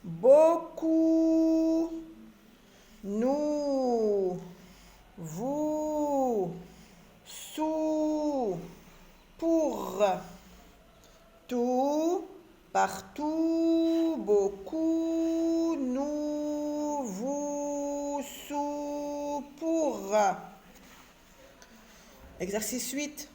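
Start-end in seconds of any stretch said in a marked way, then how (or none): 0.0-10.1 long held "ou" in "Beaucoup", "Nous", "Vous", "Sous", "Pour"; slow, sustained "ou" drill, "Vous" and "Sous" held high; elongated vowel. Shown as a demonstration. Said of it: "on allonge un petit peu le son". 11.5-20.1 "Partout", "Beaucoup", "Nous", "Vous" linked in long sustained "ou" tones; near-monotone high chant, stretching "Sous" and each "ou"; elongated vowel. Shown as a demonstration. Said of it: "on allonge un petit peu le son".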